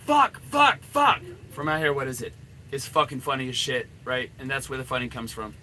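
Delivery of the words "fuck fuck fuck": high, loud cursing burst; frustrated outburst. Acted. The anxious inner voice during a pickup, loud frustrated cursing. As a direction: blurt it out, rattled and furious.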